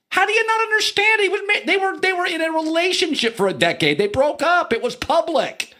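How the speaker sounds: can't believe they don't get it; incredulous. Real, not acted. Host ranting at people who don't understand Durant, exasperated.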